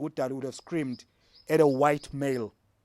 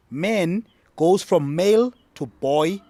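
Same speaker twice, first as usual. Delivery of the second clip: male stretched with a rising tune, louder and slow; deliberate teaching stress; slow emphasis; 3 semitones higher, 7 dB louder, slower than usual. Shown as a demonstration. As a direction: lay out man goes from male to boy step by step. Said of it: "spelling out the stages".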